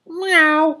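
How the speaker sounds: very high loud meow; emphatic cat meow. A narrator's reading. Cat agreeing with the boy, high loud meow. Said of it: "one of the cats agreed emphatically".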